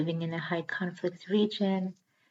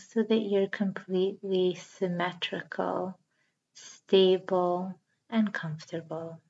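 calm, even yoga nidra guiding voice; guided meditation. Acted.

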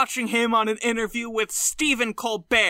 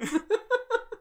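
much higher, soft gleeful cackle; high villain laugh; 13 semitones higher, 5 dB quieter than usual. Retold or imitated. Mulaney gloating at the camera, high cackle.

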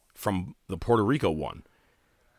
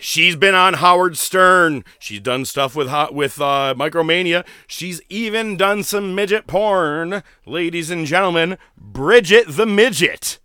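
loud, raised, stretched-out introduction; ring announcer; 6 semitones higher, 6 dB louder than usual. Retold or imitated. Promoter introducing Bridget to the crowd, big announcer voice. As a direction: imitate an emcee building to the name.

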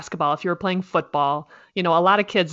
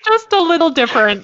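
said through a laugh, higher and louder; 10 semitones higher, 8 dB louder than usual. Real, not acted. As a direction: laugh the words out. Laughing at the Illinois joke.

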